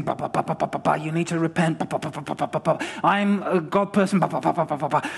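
a hint of a hammed-up street preacher; mock pushy preacher. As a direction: lightly parody a Bible-basher steamrolling people. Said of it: "doesn't kind of go in all guns blazing".

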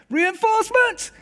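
very high, strained mock call for help; high-pitched hammed-up call for reinforcements; calling for backup. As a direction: yelp it out as if summoning troops.

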